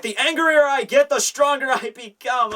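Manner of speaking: high, put-on boast of a powered-up fighter; mock brute. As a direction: parody the catchphrase, pitched well up. Mocking a copied character catchphrase.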